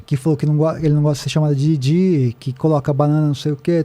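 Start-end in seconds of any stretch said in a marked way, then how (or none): none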